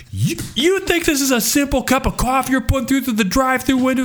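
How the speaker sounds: high, indignant mock-outrage; outraged barista rant. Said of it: "I'm so, I'm so inflamed".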